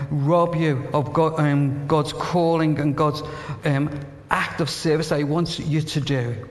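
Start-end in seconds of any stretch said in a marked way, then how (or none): none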